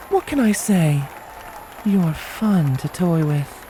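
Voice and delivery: high, slow playful mare voice; slow high teasing purr; teasing Scarlet. A narrator's reading. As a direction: toy with him, drawn out and slow. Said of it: "she teased".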